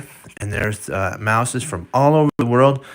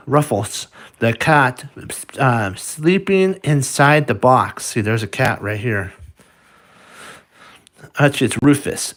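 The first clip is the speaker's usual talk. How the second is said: ordinary delivery, nothing marked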